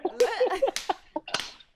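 high, loud burst of laughter; big laugh. Real, not acted. Guest laughing hard at the host's Vegas tease, high loud laughter. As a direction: crack up at the teasing.

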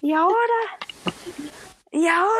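high, hammed-up politician impression; Abdalá. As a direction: mimic an ex-president, high and exaggerated. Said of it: "Parecía Abdalá". (imitación aguda y exagerada de político)

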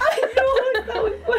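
laughing wince. Real, not acted. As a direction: overcome with laughter. Reacting to story.

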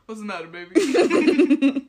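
slow, loud, high laughing line. Real, not acted. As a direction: crack up while saying it. Punchline of Updog joke.

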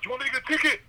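high mock yell again at him; retold yell. Shouting at the honking old man, high mock yell. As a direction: repeat the yell up high, hammed up. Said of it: "And I yelled it four times".

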